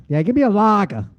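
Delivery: put-on Boston accent. Lager lover ordering at a bar, Boston accent. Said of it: "some people love 'em".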